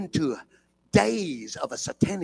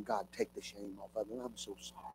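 tearful prayer; 7 semitones lower, 16 dB quieter than usual. Retold or imitated. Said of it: "I was weeping and crying".

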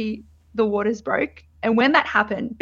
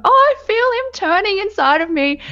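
amazed; 10 semitones higher, 4 dB louder than usual. Retold or imitated. Feeling the baby rotate.

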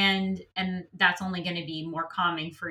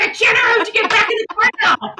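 frantic shout; 14 semitones higher, 9 dB louder than usual. Retold or imitated. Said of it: "You're like".

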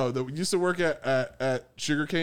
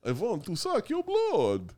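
put-on slow, higher vampire accent; Dracula; 6 semitones higher than usual. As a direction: do a hammy vampire. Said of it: "like Dracula".

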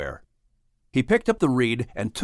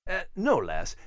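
put-on Scottish accent; 5 semitones higher, 5 dB quieter than usual. Narrator teases in Scottish voice.

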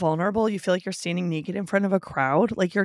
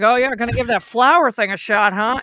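loud, higher mock-uncle voice; weird uncle; 4 semitones higher, 8 dB louder than usual. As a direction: imitate a needling uncle, booming it out. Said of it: "some weird ass uncle who's like".

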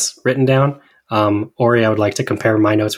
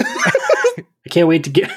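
laughing; much slower than usual. Retold or imitated. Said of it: "it's that New England again".

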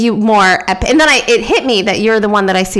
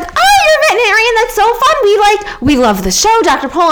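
high, rushed fan gushing; gushing enthusiasm; 9 semitones higher than usual. Retold or imitated. Gushing to a new acquaintance about being a veterinarian, overexcited. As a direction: re-enact overeager small talk, pitched up then settling.

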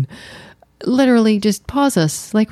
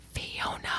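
reverent, drawn-out whisper of a name; barely voiced, savored name; breathy whisper; 20 dB quieter than usual. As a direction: whisper the name with mock awe. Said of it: "say it like that".

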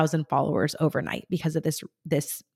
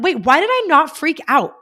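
higher, louder squeal of regret; mock exasperated outburst; 10 semitones higher, 8 dB louder than usual. Fan scolding herself for missing the moment, mock exasperated outburst. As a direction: kick yourself out loud.

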